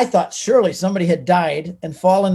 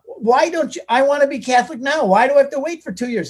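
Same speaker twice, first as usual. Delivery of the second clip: high-pitched, impatient retold objection; indignant protest; 7 semitones higher than usual. New convert objects to priest about waiting, indignant. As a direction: plead against the delay, voice pushed up high.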